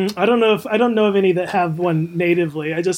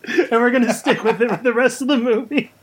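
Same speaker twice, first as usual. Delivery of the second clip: mock Guy Ritchie; 5 semitones higher than usual. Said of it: "Me, Guy Ritchie".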